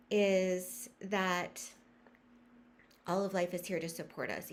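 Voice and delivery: ordinary talk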